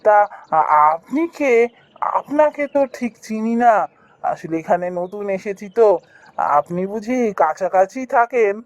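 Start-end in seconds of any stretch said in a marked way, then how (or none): none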